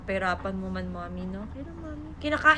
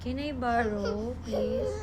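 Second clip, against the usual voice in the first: tearful plea; 2 semitones lower, 8 dB quieter, slower than usual. Real, not acted. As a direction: drag it out and break into a wail. Child crying for charger.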